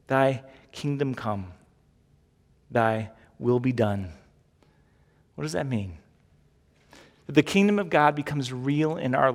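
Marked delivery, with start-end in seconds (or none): 0.1-4.1 slow, reverent recitation; prayer quote. Acted. Pastor reciting the Lord's Prayer slowly to the congregation. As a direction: let each phrase hang. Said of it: "quoting the Lord's Prayer".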